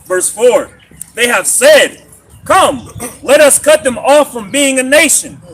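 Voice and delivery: loud, high proclamation of scripture; scripture reading. Acted. Reading the Psalm for the teacher to expound, loud proclaimed scripture. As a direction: ring out each phrase of the verse.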